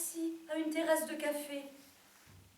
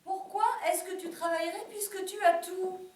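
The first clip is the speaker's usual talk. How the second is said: higher, louder husband quote; lightly acted husband, pitched up and insistent; mari qui s'étonne; 7 semitones higher, 8 dB louder than usual. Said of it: "Mon mari me dit". (mari étonné, plus aigu et plus fort)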